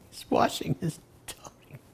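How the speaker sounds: breathless whisper shaking with held-in laughter; laughing whisper. Retold or imitated. Prabhupada repeating the devotee's answer to himself, laughing whisper. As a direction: whisper it while barely holding back a laugh. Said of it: "Prabhupada started to laugh".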